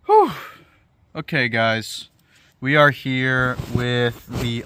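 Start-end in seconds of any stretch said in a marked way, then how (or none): 0.1-0.3 long exhausted whew. Real, not acted. End of rant.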